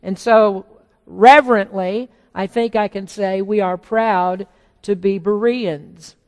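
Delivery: ordinary talk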